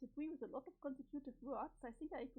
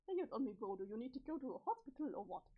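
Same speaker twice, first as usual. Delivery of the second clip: ordinary delivery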